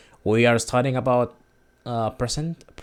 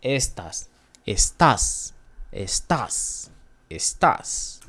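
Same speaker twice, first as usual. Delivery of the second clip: estás drilled with a stressed final tás; stress on last syllable; slower than usual. Shown as a demonstration. Teacher drilling you are, estás stressed on TÁS. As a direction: push the TÁS of estás on each repeat. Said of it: "volume of your voice when you get to as".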